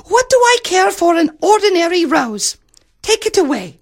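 very high, petulant pout; pouting princess. A narrator's reading. Princess refuses an ordinary rose, pouting high. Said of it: "The princess pouted".

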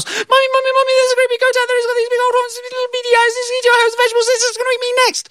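put-on very high frantic child's voice; gabbled panic; panicked little girl. Girl crying to her mother about the goat, very high and frantic.

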